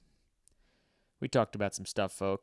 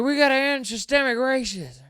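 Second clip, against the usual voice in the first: much higher, loud mock speech yell; yelled speech line; 9 dB louder than usual. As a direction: shout the slogan like a rally speech, even the key words. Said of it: "he'll yell in a speech".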